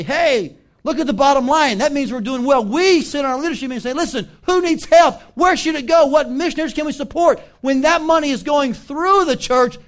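high, loud, driving sermon voice; full-force preaching. Real, not acted. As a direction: fire it out to rally the church. Preacher at full force, acting meetings.

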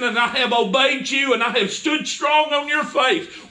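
staring up into pouring rain, crying out to God; anguished cry to heaven. Retold or imitated. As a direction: demand an answer from God in the rain.

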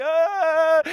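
high, loud triumphant yell after the shot; excited cry. Retold or imitated. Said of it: "I was like".

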